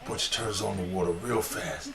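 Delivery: ordinary talk, nothing marked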